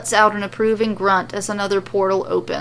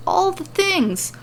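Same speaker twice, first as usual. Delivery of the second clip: higher, slow, drawn-out emphasis; wry storyteller emphasis; emphatic aside; 11 semitones higher, slower than usual. A narrator's reading. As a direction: stress the sheer amount. Narrator emphasizes excess.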